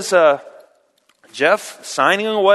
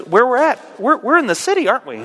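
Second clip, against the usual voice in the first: higher, puzzled blindfolded professor; 6 semitones higher, faster than usual. Acted. Professor blindfolded by Jeff, puzzled and higher. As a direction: wonder aloud where you are. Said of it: "the professor looks at us and he goes".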